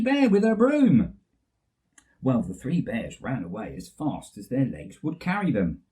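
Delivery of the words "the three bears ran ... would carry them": usual speaking voice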